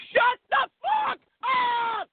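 high, drawn-out hammed-up yell; mock furious shout. Pretend husband silencing his nagging wife, shouting. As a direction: stretch each word into a high shout.

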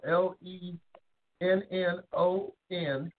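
each letter said slowly and separately: L E N N O N; spelling it out. Shown as a demonstration. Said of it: "is spelled".

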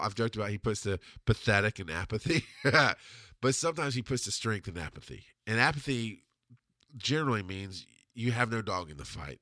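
normal talking voice

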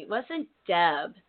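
normal talking voice

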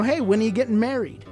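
usual speaking voice

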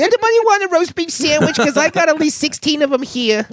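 put-on high-pitched hostess calling to all the kids.

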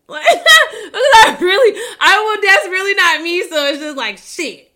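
high, loud laughter breaking into the words; laugh-cry it out, really high and loud; laughing through tears. Real, not acted. Laughs while admitting she's not ballsy.

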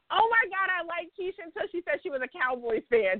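high-pitched mock dismay; dismayed listeners. Host voices New York listeners hearing she's a Cowboys fan, mock dismayed.

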